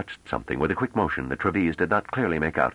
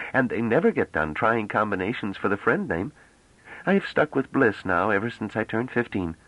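put-on a young woman's higher voice, breezy and talkative; chatty young woman; 6 semitones higher than usual. Bliss chatters to the men about her long name. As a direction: rattle on about names, amused.

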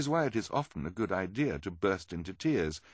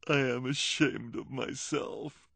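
put-on unaccented, ashamed; 6 semitones higher, much slower than usual. Lulu dropping her act and confessing to the children. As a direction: drop the accent and admit it sadly. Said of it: "in an unaccented voice".

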